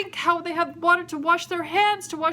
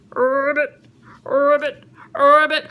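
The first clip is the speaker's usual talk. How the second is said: rolled, throaty ribbit; frog croak; 4 semitones lower, 5 dB louder than usual. Acted. Storyteller voices the frog from the river, croaking.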